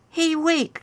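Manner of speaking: put-on a giddy boy calling after her. A narrator's reading. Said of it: "called Jay".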